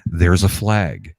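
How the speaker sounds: slow, deadpan announcer drawl; boring announcer. Retold or imitated. Mocking the boring stadium announcer, slow and flat. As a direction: flatly call out the flag, slowly. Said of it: "the announcer goes".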